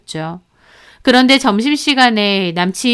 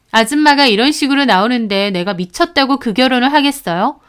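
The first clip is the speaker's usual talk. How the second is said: ordinary delivery, nothing marked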